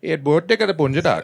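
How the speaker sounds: high-pitched Indian-accented mock Hindi; mock Hindi punchline. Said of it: "punchlines are in Hindi".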